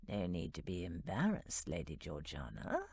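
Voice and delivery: low, soft teasing purr; almost purring. A narrator's reading. The Duke teases Georgiana in the dark, low soft purr. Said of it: "he almost purred".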